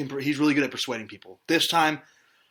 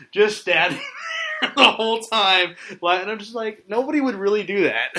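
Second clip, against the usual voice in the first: higher, louder, breaking into laughs; laughing disbelief; 8 semitones higher, 6 dB louder than usual. Real, not acted. Podcast host mocking a hero pose to Joey, laughing disbelief. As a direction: ridicule it through laughter.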